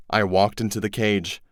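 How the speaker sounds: ordinary delivery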